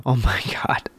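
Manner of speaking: breathless whispered disbelief. Real, not acted. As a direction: whisper it through a laugh. Amused disbelief.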